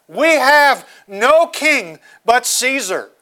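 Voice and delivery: slow, heavy, every word weighted on "no"; grave emphasis. Shown as a demonstration. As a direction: let "We have no king but Caesar" land with full gravity. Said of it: "Hear the gravity of this last phrase".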